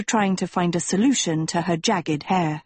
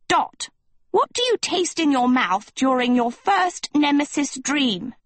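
stern teacher; 7 semitones higher than usual. A narrator's reading. Lady Lesso interrogating Dot.

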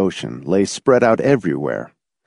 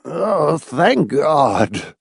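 a touch of pitiful relief, pitched up; piteous mutter; 7 semitones higher, much slower than usual. A narrator's reading. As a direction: moan it piteously, mildly. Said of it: "muttered piteously".

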